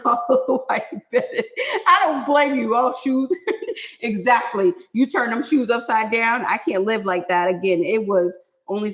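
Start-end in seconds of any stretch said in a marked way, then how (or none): none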